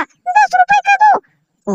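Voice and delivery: very high, slow squeaky toy voice; Superman toy voice. Pretend. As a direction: squeak the price like the little Superman shopkeeper. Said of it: "कितने का है Superman भैया?". (Superman खिलौने की बहुत ऊँची पतली आवाज़)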